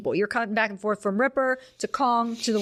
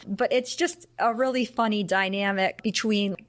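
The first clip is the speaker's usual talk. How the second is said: ordinary talk, nothing marked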